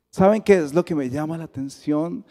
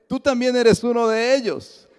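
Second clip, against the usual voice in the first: accusing shout; 9 semitones higher, 5 dB louder than usual. Retold or imitated. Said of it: "el segundo hombre que aparece".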